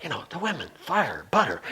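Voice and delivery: soft aside whisper. Retold or imitated. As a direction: lean in and whisper it. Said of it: "Jayatirtha whispers to me".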